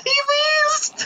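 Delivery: squealing it out in delight; excited shout. Real, not acted. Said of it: "Legit wheeze".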